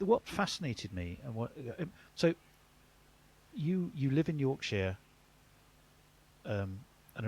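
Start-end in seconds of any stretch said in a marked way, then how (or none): none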